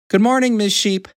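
high-pitched hen greeting; cheery hen character. A narrator's reading. Little Red Hen greeting Ms. Cow, high character voice.

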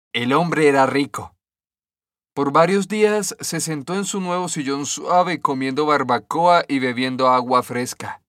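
usual speaking voice

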